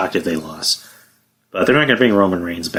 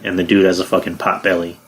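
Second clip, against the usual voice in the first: ordinary delivery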